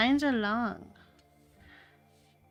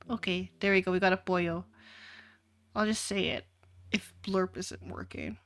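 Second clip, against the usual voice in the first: ordinary talk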